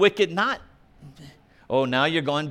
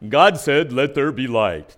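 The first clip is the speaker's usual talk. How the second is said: a booming voice of God; solemn voice of God; 4 semitones lower, 5 dB louder than usual. Shown as a demonstration. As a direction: intone it like God speaking creation. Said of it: "You gotta say it like that".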